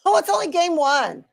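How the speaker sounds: high surprised "oh"; surprised it's only the first game. Real, not acted.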